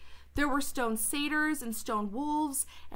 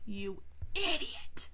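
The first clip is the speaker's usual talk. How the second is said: slow whispered jab at a character; breathy, drawn-out mutter of contempt; exasperated aside; 4 dB quieter, slower than usual. Real, not acted. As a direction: whisper it slowly in disbelief. Reader mutters insult at Edmund aside.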